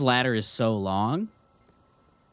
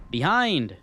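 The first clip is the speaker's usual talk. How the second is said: sharp loud warning; sudden warning shout; 5 semitones higher, 6 dB louder than usual. Real, not acted. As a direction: call out the danger. Warning call in game.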